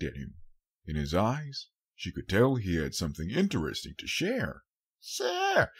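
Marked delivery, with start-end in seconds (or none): 5.0-5.7 very high eager cry; a child bursting with curiosity; eager filly squeal; excited squeal. A narrator's reading. Child exclaims.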